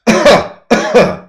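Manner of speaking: loud, high voiced demonstration cough; vocalized cough. Shown as a demonstration. Instructor demonstrating for the patient, vocalized cough. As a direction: cough with a bit of voice. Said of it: "bit of vocalization in the cough".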